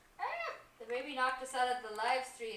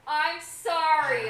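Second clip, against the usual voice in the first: mock apology; 2 semitones higher, 7 dB louder, much slower than usual. Mock apology for criticised accent.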